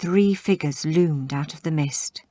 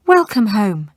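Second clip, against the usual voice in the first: welcoming; 5 semitones higher, 7 dB louder than usual. Acted. Greeting husband.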